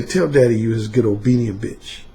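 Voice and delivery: gentle seductive tease; low soft seductive voice; smooth seductive. Shown as a demonstration. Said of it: "There's no harshness in the tone".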